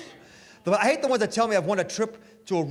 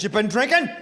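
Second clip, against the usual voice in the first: louder, accusing mock cop question; mock cop grilling; 4 semitones higher, 6 dB louder than usual. To the cop who hit him, mock cop grilling. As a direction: play the tough cop catching the drunk.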